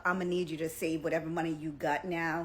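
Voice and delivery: ordinary talk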